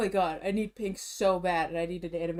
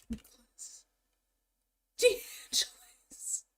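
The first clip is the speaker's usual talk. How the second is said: whisper; 12 semitones higher, much slower than usual. Real, not acted. Vlogger whispering a name while typing in search. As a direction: whisper the name as you type it.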